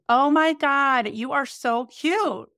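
drawn-out mock gush, Oh my God; gushing stranger. Stranger in a car gushing at her, mock gushing. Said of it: "she goes".

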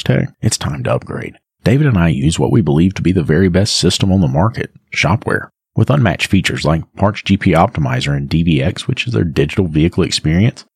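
confident sponsor pitch; ad read. Acted. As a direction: pitch it like a radio ad.

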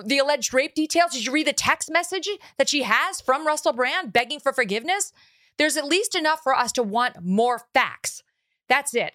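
pleading hard, voice climbing high; heated rant. Real, not acted. Heated plea to open minds.

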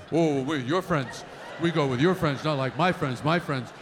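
low, dopey man impression; mocking man's voice. Wife to husband, mocking his low dopey voice. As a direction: play the whiny boyfriend, low. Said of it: "You go".

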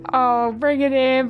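tearful call. Acted. Repeated call to hug.

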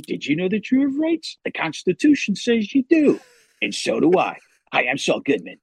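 high-pitched lawyer pitch, dropping at the end; Saul Goodman. Acted. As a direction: sell it like a TV-ad lawyer.

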